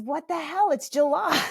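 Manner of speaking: high, loud disbelief; flabbergasted. Retold or imitated. Mother frozen when her kid asks about Santa, flabbergasted. Startled inner reaction to Santa question.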